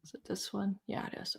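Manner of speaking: very soft, near-whispered aside; quiet self-talk. Real, not acted. Host checking "is it this one" for the next clip, murmured to self. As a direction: murmur to yourself while checking. Mutters while searching for a clip.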